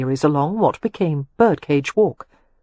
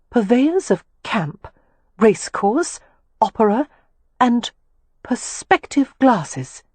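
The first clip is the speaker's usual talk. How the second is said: slow, higher, puzzled spelling-out of a shop sign; reading aloud; 6 semitones higher, slower than usual. Acted. The lady reads the telescope shop sign aloud for Leo, slow and puzzled. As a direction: read the sign word by word, slowly and uncertainly. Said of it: "she read aloud".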